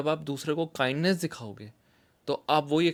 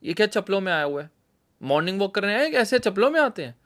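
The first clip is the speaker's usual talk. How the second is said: scolding father; 5 semitones higher, 6 dB louder, faster than usual. Retold or imitated. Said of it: "father scolding in park".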